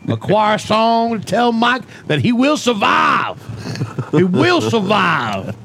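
slow, high sermon; preacher voice. Acted. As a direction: preach slowly to the flock. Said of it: "this new character called Pastor Pat".

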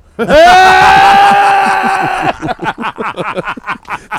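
high howling burst of laughter; loud shrieking laugh. Real, not acted.

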